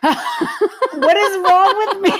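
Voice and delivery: loud, high words squealed through laughter; helpless laughter. Real, not acted. Host shocked at own dead body thought, helpless laughter. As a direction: crack up while saying it, drawn out.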